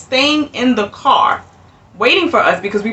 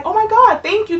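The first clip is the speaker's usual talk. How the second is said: excited thanks; 12 semitones higher than usual. Retold or imitated. Couple's grateful reaction.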